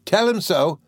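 high, mildly indignant echo; lightly indignant comeback; retort. A narrator's reading. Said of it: "retorted Peggotty".

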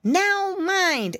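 put-on piggy boy warning the mouse girl, urgent; urgent exclaim. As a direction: snap out a quick caution. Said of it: "exclaimed Curly Tail".

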